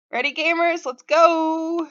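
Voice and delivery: high, loud rallying call; rally the gamers with gusto; hyped. Real, not acted. Streamer kicking off the actual gaming, hyped.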